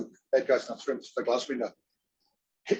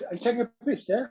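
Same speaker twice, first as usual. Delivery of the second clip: put-on Cockney accent; 9 semitones higher than usual. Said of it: "full on with a Cockney accent".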